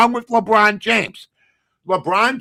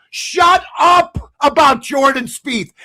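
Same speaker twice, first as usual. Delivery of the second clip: angry shout; 6 semitones higher, slower than usual. Real, not acted. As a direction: tell them to shut up. Ranting at pundits.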